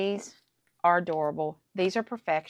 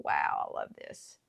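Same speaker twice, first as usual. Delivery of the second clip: breathless whisper, much lower; whispered awe. Real, not acted. Crafter admiring her stamped circle, awed whisper.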